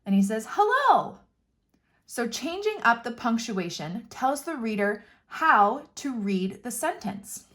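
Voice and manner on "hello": "Hello." shouted much higher and louder; excited pigeon yelling hello. Shown as a demonstration. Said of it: "exclamation marks to yell something".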